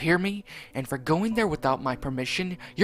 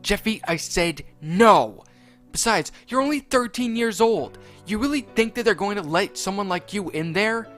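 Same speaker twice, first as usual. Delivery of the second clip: Mario scolding; 8 semitones higher, 7 dB louder than usual. Acted. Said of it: "Mario said".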